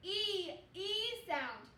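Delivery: E stretched out slowly; teacher modelling a long E, slowly; long E sound demo. Shown as a demonstration. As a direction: draw out the E so kids hear it. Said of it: "it says the".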